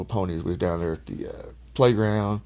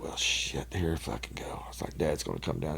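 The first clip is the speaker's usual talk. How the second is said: 1 dreading mutter; 5 semitones lower, 11 dB quieter than usual. Retold or imitated. Dreading dad's arrival.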